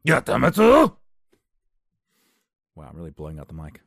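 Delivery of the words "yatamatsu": high, loud shouted Japanese; anime shout. Retold or imitated. Imitating anime Japanese, loud and high. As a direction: yell it like a pained anime hero. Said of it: "I just said, "My head hurts," in Japanese".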